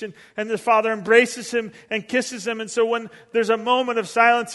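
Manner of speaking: fervent preacher building to the climax; preaching at full force. Acted. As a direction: drive the story home with rising passion. Sermon climax, raised voice.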